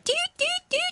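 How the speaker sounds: high squeaky doot doot noises; guinea pig doot. Shown as a demonstration. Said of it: "fantastic impression".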